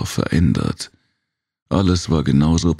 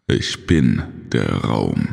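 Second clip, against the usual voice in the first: ominous Kirai; 5 semitones lower, slower than usual. A narrator's reading. Cosmic Kirai claims power.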